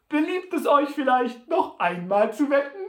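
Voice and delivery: high, loud mock-polite offer; taunting call. A narrator's reading. Sam taunts beaten Butler with another bet, calling out. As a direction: call out the question to needle him. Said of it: "rief ihm Sam Hawkins zu".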